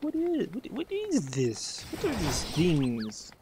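high, stretched-out bewildered questions; slow down in wide-eyed wonder; baffled amazement. Retold or imitated. Player retelling his first look at strange creatures, high and slow. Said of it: "I was like".

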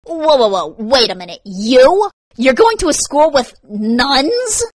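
loud, high, mocking disbelief; gleefully disbelieving; incredulous. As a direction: stretch the question out, astonished and amused. Mocks her school in disbelief.

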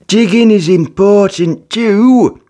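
a kind friend, slowly; kindly. Acted. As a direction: reassure him gently.

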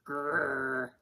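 short rolled "grrr"; imitated dog's growl. A narrator's reading. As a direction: growl like a grumpy dog. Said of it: "growled Perky".

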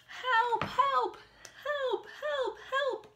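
high, loud calls for help; cry for help. A narrator's reading. Stuck little truck cries out for help. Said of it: "cried Little Blue Truck".

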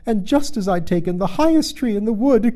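high, loud aggrieved bird; voice rising toward shriek. A narrator's reading. Pigeon tells of its ruined refuge, voice rising. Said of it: "raising its voice to a shriek".

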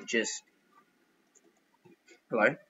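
ordinary delivery

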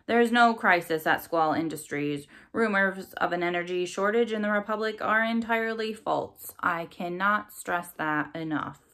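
ordinary delivery

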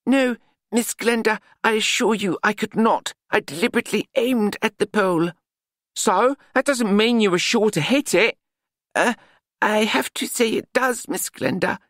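sincerely offering to fix things; polite Nutt voice. A narrator's reading. Nutt answers Glenda.

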